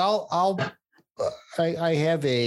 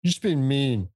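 put-on whiny kid; 8 semitones higher than usual. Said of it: "they're like".

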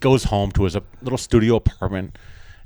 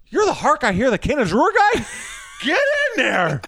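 very high, incredulous squeal of recognition; hammed-up high-pitched astonishment; starstruck amazement; 13 semitones higher than usual. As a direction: play an amazed ticket taker, stretching the final order. Ticket taker amazed, waves him in.

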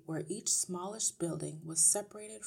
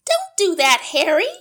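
high, very loud protest; crying out; 12 semitones higher, 21 dB louder than usual. A narrator's reading. Wife objects as TV sound goes down, crying out. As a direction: yelp at your husband in outrage. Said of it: "Missus Wormwood cried out".

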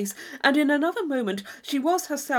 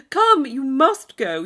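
louder and slow, pressing; coaxing insistence; 5 semitones higher, 10 dB louder, slower than usual. Acted. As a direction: drag her along sweetly. Isabella urging.

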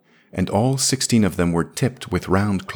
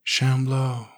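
somber; 6 semitones higher than usual. A narrator's reading. Alien girl somberly naming what she is. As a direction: say her people's name with gravity. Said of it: "She said it somberly".

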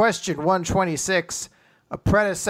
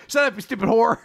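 mock angry insult; 6 semitones higher, 4 dB louder, faster than usual. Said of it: "But in my mind, she's like".

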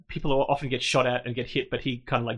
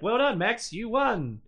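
much higher, bright praise; cheering; 8 semitones higher, slower than usual. Retold or imitated. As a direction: cheer the pilot on. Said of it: "everyone's like".

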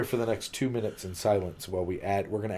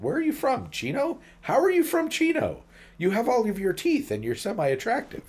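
mocking high voice; 14 semitones higher than usual. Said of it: "you're like".

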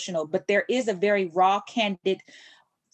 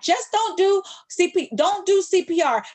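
urgent instructions; 9 semitones higher, 4 dB louder than usual. Retold or imitated. Dispatcher instructing over the phone.

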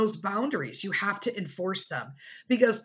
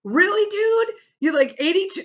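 much higher and louder disbelief; exasperated sister; 12 semitones higher, 7 dB louder than usual. Retold or imitated. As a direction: scold dad like he's a child. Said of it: "she called my dad and she's like".

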